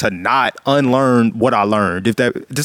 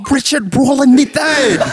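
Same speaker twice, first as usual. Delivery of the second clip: a hint of a posh British accent, slowed down; lightly put-on British accent, drawn out; mock-grand British accent, half-hearted; 9 semitones higher, slower than usual. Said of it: "were you going to give us a British accent".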